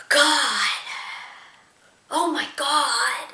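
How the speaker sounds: slow, drawn-out groaning "oh my God"; sleep-talking boyfriend.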